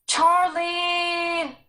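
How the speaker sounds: loud, high call held out a long time. Acted. Sarah calling Charlie across the valley, long held call. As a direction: stretch the name out as far as it carries. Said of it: "She made the sound of it last a long time".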